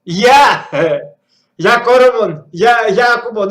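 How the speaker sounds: loud cheerful yell of hello; excited loud hello; loud greeting. Real, not acted. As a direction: shout a greeting at the screen. Greeting the game character loudly.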